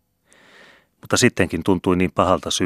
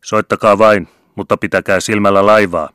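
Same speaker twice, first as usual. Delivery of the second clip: louder call, pitched up; shouted answer; 3 semitones higher, 8 dB louder than usual. A narrator's reading. Vicar answering the band from the beach, loud shout. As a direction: shout it back to the pier.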